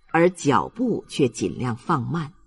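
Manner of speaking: ordinary delivery, nothing marked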